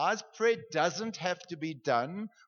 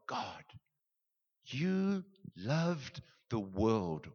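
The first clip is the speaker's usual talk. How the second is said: prayer building up; 4 dB louder than usual. Shown as a demonstration. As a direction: begin hushed, then burst into wonder. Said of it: "I just pause there and I'm like".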